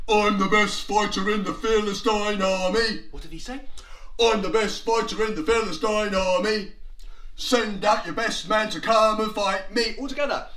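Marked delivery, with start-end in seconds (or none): 0.1-3.0 loud, boastful giant's challenge; Goliath. Acted. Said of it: "And Goliath said". 4.2-9.9 loud, boastful giant's challenge; Goliath. Acted. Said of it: "And Goliath said".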